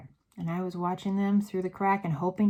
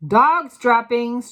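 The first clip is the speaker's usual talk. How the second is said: slow, loud, higher yell leaning on dogs; 5 semitones higher, 12 dB louder, slower than usual. A narrator's reading. Witch yelling the smell to the room, slow loud yell. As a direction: drag it out in disgust. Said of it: "she yelled".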